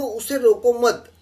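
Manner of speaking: उसे रोको मत high, loud and slow, stopping on मत; pause after मत. Shown as a demonstration. Teacher showing where the comma sits, slow stop after मत. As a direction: read up to the comma after मत, drawn out. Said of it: "comma दिया है".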